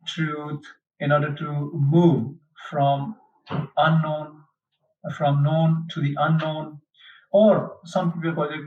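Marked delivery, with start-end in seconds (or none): none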